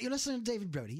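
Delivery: put-on David Brody in a crisp British accent; a light mock British radio intro; proper British accent. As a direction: announce it like a posh British radio host. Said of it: "it sounds so much more proper".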